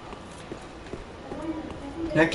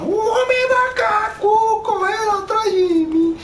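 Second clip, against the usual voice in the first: mock wail; 12 semitones higher than usual. Chased by ape-man monster.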